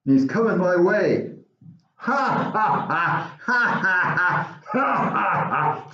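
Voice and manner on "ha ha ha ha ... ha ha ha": high, slow taunting ha ha ha; mocking bully laugh. Bully Tony laughs at George before slapping his sandwich. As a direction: laugh down at the little kid.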